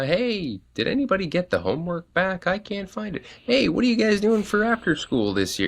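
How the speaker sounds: high, bright chirpy parent messages; voiced group chat messages.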